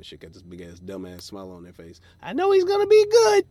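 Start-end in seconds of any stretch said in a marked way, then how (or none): 2.2-3.5 high, loud chirpy fan voice; mock cheerful fan. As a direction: play a smiling optimistic audience member.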